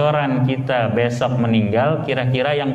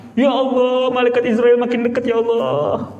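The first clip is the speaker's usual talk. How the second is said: higher, faster mock panicked crying; a much higher hammed-up wail as the angel nears; crying harder; 8 semitones higher than usual. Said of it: "Tambah nangis kan?". ([name] makin menangis, suara tinggi pura-pura panik)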